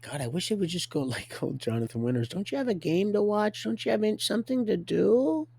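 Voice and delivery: high-pitched mock wife voice; hammed-up high wifely pleading; nagging wife. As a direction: imitate a wife wanting her husband gone. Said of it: "Some of you wives are like".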